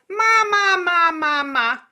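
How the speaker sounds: very high, loud nasal ma, sliding down; nasal calling. Shown as a demonstration. Teacher showing the nasal placement drill, loud and nasal. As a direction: belt it out through the nose. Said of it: "We can then do that on all the vowels".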